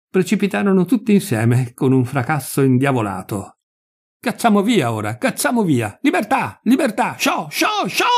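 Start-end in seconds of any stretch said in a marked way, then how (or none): 4.2-8.1 high cries building to a shrieked shoo; excited shouting. A narrator's reading. Freeing the caged birds, excited high shouting. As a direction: shout higher and higher to drive birds out.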